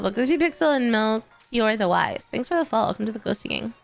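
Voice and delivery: ordinary talk, nothing marked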